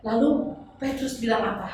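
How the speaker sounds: ordinary talk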